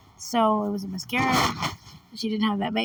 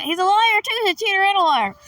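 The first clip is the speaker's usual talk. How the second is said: louder, higher, quicker accusation; calling out; 8 semitones higher, 6 dB louder than usual. Real, not acted. Player scolding the lying game character, calling out. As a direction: call him out loud and high.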